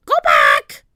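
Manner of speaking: loud, screeching parrot voice; parrot squawk. A narrator's reading. Polly warning the pirates from above, squawking. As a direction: screech the words like a bird. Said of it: "she squawked".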